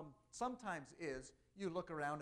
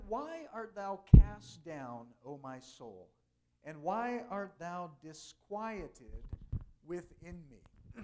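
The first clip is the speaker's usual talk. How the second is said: slow, loud, drawn-out psalm reading; solemn scripture reading; 13 dB louder, much slower than usual. Acted. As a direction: intone each line slowly like a psalm over music. Reads Psalm forty-two aloud.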